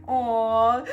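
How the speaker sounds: loud letdown aw; reacting to a four hundred dollar price; disappointed aw. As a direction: sigh it out, let down. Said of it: "And I was like".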